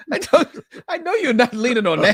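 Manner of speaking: high, loud words through laughter; laughing. Real, not acted. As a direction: laugh your way through the line. Laughing while teasing co-host.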